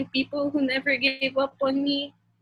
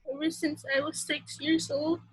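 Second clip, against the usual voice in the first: ordinary delivery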